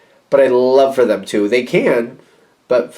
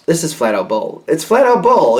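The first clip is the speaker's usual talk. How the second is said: indignant outburst, voice shooting higher; indignant retort; 5 semitones higher than usual. Acted. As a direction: call it out, pitch jumping up. Acted indignant retort to excuses.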